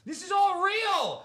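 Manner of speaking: slow, high and loud, every word hammered; slow, high, loud insistence; emphatic shout. Real, not acted. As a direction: shout it slowly so nobody doubts it. Stressing that the story is true.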